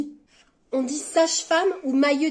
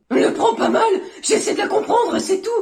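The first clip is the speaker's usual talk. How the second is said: high, loud protesting; defensive protest; 9 semitones higher, 4 dB louder than usual. Acted. Friend excusing a tactless remark to a mate, defensive. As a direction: backpedal loudly in a raised pitch.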